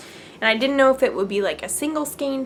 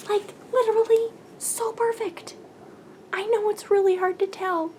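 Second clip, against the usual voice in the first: much higher, gushing delight; 10 semitones higher than usual. Real, not acted. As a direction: swoon over how perfect it is. Gushing over matching yarns.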